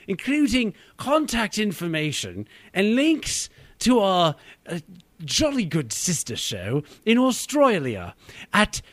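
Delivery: a jolly good British send-off; British accent. Pretend. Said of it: "we should do a British version of this".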